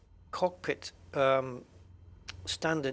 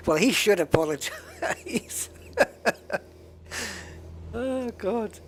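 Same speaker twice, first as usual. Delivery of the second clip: laughing; 6 semitones higher, slower than usual. Real, not acted. Joking about apologies.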